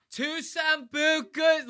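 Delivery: put-on very high, slow drunken slur; wasted girl. Drunk girl still ordering from the DJ, slow high slur. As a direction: drag it out high like she's blackout drunk. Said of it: "she goes".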